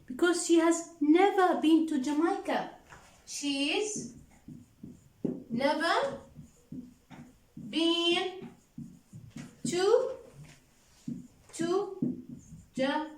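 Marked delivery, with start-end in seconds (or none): 3.2-13.1 one word at a time, long pauses between; slow dictation. Shown as a demonstration. Teacher dictating to the class, word by word with pauses. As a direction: spell it out slowly so the class can write. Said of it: "teacher dictates word by word".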